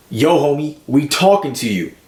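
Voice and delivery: high, loud challenge to a stranger; imitated mugger's demand. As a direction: call him out, demanding his attention. Mugger confronts him after grabbing him.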